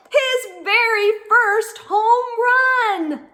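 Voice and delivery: building excitement. Acted. Reader at the climax of the story, triumphant. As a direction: build the countdown to a cheer.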